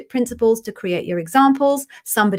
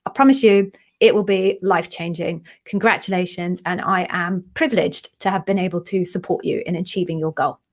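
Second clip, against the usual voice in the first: ordinary talk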